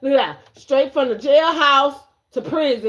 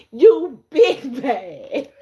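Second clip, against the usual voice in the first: laughing taunt; 4 dB louder, much slower than usual. Real, not acted.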